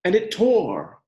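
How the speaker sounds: gentle, expressive picture-book reading; reading to children. Acted. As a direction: read it like a storybook for the class.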